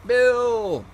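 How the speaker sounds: loud, high, drawn-out greeting cry; big welcoming holler, falling at the end; hailing a friend. Real, not acted. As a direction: sing out the name as a friend turns up. Hailing Bill arriving in live chat.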